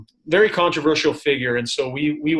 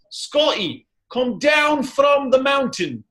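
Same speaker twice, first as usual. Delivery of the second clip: a rough Scottish accent, higher and slow; 11 semitones higher, much slower than usual. Shown as a demonstration. Scottish accent, answering a fake accents question. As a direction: try a Scottish accent, drawn out. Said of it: "the only thing I can say in a Scottish accent".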